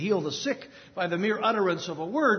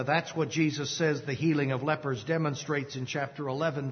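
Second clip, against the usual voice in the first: ordinary delivery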